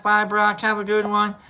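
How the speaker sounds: usual speaking voice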